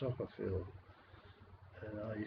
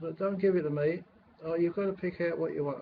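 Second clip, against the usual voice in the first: higher and louder, irritated; retold angry retort; 5 semitones higher, 4 dB louder than usual. To the meal staff over breakfast, irritated raised voice. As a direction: snap back at the staff, fed up.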